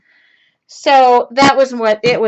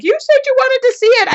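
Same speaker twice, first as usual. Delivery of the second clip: teasing son; 14 semitones higher, 5 dB louder than usual. Retold or imitated.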